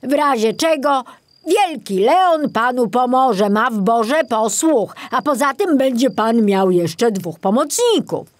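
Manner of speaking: put-on old forester encouraging his successor; reassuring old forester. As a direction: promise him help. Kobiela's voice, reassuring.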